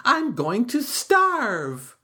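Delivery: high, slow worried wail; high, anxious turtle voice; crying out. A narrator's reading. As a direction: wail it, desperate and frightened. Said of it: "cried Turtle".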